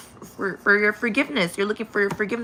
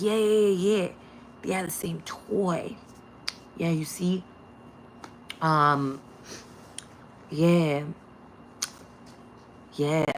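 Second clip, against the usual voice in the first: smug mock agreement; 2 semitones lower, 6 dB quieter than usual. Mocking the smug neighbors.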